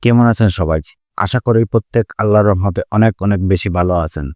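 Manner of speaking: ordinary delivery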